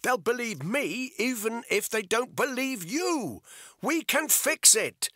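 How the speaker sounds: high, loud persuasive shouting. A narrator's reading. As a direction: keep shouting the deal to her. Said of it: "he shouted".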